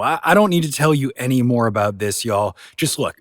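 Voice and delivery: ordinary delivery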